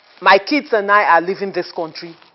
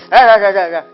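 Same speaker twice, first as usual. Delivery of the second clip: scornful staccato laughter; taunting laugh; mocking laugh; 5 dB louder, faster than usual. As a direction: laugh at him.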